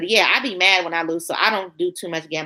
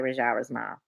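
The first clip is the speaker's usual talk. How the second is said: quiet repeat; 9 semitones lower, 9 dB quieter than usual. Shown as a demonstration. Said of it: "I hope I'm saying that right".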